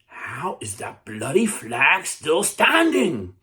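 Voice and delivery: enraged British captain, drawn out; furious captain. Retold or imitated. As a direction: rage at the flag that won't fall.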